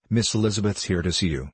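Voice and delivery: ordinary delivery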